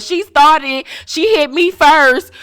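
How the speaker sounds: put-on high, loud whiny kid voice; little kid. Host mocking Marie as a tattling child, high and whiny. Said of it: "Sound like a little kid".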